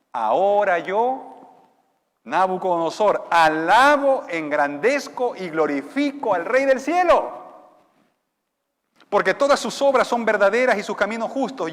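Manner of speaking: loud, slow royal confession; proclaiming king. Acted. Nabucodonosor loudly confessing the King of heaven. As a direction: declare it like the humbled king. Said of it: "miren lo que dice Nabucodonosor".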